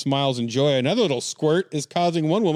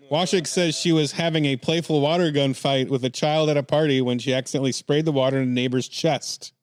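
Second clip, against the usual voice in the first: ordinary talk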